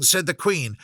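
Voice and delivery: usual speaking voice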